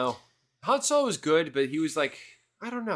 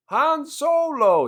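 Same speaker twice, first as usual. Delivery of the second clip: slow, much higher and louder hammed-up cry of "Han Solo!"; mock excited shout; 11 dB louder, much slower than usual. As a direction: overplay the hero's excitement. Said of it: "but he's never excited".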